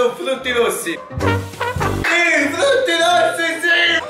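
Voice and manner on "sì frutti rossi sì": excited high shout. Real, not acted. Excited choice.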